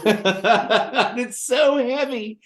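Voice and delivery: put-on very slow, high British accent through laughter; British accent, laughing. Imitating Lorna handing over a heavy trophy, British accent. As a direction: laugh out a British lady's line. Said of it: "in her lovely British accent".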